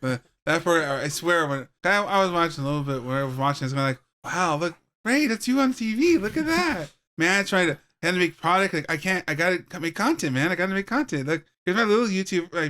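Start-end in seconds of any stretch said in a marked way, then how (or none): 4.2-6.9 excited. Retold or imitated. Acted excited quote.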